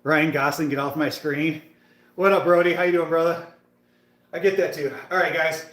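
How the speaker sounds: ordinary talk, nothing marked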